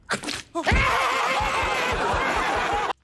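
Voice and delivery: bursting into a high shrieking laugh; cracking up hard. Real, not acted. Laughing at the spit kink text.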